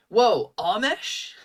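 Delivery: high, slow whoa of delighted recognition; surprised tabaxi. Acted. Tabaxi spotting an old friend, high surprised whoa. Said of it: "he's like".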